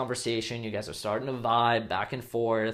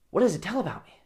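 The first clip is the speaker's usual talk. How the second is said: put-on curious girl; 9 semitones higher, much faster than usual. Imitating hooked girl.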